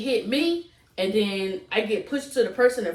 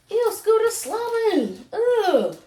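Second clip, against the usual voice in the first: disgusted; 12 semitones higher, 4 dB louder, much slower than usual. Real, not acted. Dog drooling, disgusted.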